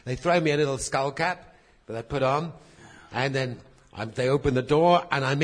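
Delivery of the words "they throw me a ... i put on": normal talking voice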